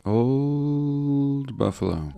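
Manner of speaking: slow and high, savouring each word; drawn-out, wistful. Acted. Savouring the name of the old city, slow and high. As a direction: stretch out Old Buffalo, reminiscing.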